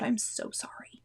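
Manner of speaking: real whisper, very soft and breathy; embarrassed whisper after the stomach growl; whispered apology. Real, not acted.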